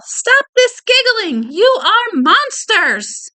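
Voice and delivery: high, slow, drawn-out scolding yell; yelling teacher. A narrator's reading. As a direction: yell it at a giggling class, stretching each word. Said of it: "The teacher began to yell".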